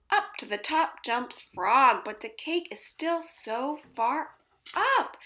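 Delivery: ordinary delivery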